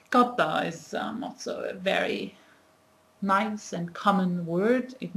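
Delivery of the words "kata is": ordinary talk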